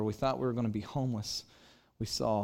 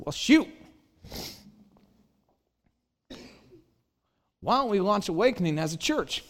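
put-on Texan accent; 7 semitones higher, 5 dB louder than usual. Pastor imitates his Texan boss offering to launch a church, Texan accent. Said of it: "in his classic Texan accent".

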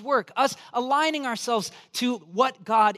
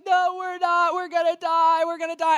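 mock panic, higher and louder, we're gonna die; panicked girl; 7 semitones higher, 4 dB louder than usual. Frightened girl answering her comforting friend, higher mock panic. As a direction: play the terrified flyer, voice pitched up. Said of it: "And she's like".

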